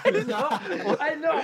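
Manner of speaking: very high, loud exclamation; delighted outburst. Real, not acted. Player reacts to a friend's punning answer, delighted. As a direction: squeal it out in delight.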